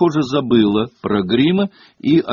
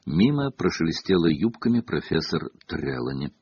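ordinary delivery

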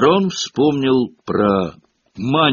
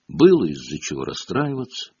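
ordinary delivery

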